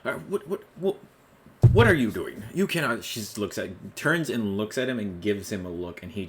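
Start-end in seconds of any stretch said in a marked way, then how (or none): 0.0-3.0 high, laughing sputter rising to a loud protest; a pompous man losing his cool; flustered husband. Acted. Husband protesting as guards clear the room, flustered and loud. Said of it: "heavier set man next to her just starts to".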